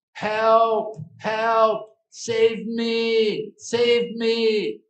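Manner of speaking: high, loud cries for rescue; calling for help. Acted. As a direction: shout out as a guru in distress. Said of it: "heard his guru calling out".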